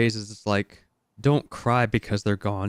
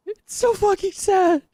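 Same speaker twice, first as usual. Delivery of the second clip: real crying squeak. Real, not acted. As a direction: let the sob break the line. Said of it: "I'm fucking crying".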